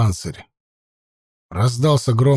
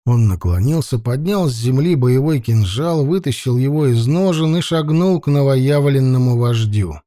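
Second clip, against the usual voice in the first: usual speaking voice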